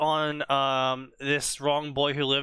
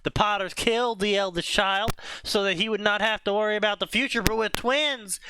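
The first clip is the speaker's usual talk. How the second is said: higher-pitched Southern storytelling voice; Southern narrator, pitched up; southern accent; 4 semitones higher than usual. Acted. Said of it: "started using the southern accent".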